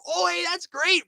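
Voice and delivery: high sarcastic squeak; mock cheer, dripping sarcasm. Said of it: "sarcastic roar".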